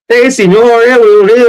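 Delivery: a playful hint of the chattering spirits; spirit voices. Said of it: "sounded like kind of Mexican".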